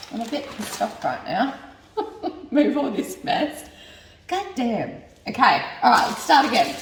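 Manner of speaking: ordinary talk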